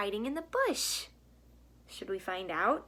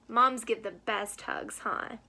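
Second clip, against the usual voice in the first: plain reading voice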